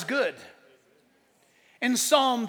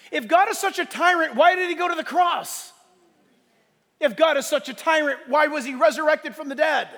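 forceful rhetorical questions, pitched up; impassioned preaching; 4 semitones higher, 3 dB louder than usual. Real, not acted. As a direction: preach it as a ringing challenge.

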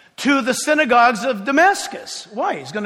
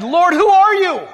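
startled cry; 8 semitones higher, 6 dB louder than usual. Acted. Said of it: "And he said".

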